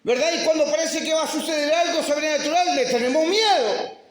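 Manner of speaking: high, loud preaching, each phrase pounded; pulpit preaching. Acted. Pastor stirring the congregation about life's storms, fervent preaching. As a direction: preach it like storms are hitting.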